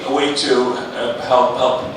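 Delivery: ordinary talk, nothing marked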